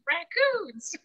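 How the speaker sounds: usual speaking voice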